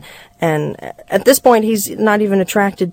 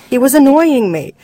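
put-on high, louder childish protest; indignant little boy; 7 semitones higher, 5 dB louder than usual. Small boy excusing the bite, indignant. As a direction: defend yourself like a cross little kid.

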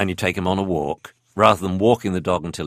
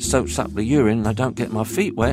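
much higher puppy voice; doggy voice; 9 semitones higher than usual. Acted. Puppy telling the Akita about its owners, high puppy voice. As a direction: play an anxious little puppy confiding. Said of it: "you want some doggy voices".

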